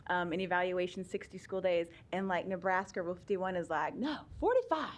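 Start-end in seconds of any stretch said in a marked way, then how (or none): 3.9-4.9 higher-pitched playful contradiction; mock firm correction. Presenter voices the Nebraska rule overruling federal law, mock firm. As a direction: correct them in a playful put-on voice. Voicing the state rule's contradiction.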